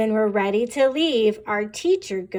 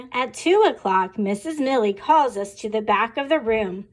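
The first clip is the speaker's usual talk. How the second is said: ordinary delivery, nothing marked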